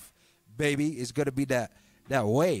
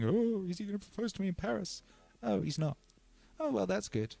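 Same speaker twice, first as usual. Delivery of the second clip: higher, softer mock-hopeful inner voice; imagined girlfriend's thoughts; 7 semitones higher, 5 dB quieter than usual. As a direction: imitate her secretly wondering, light and high. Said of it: "whole thing of thinking".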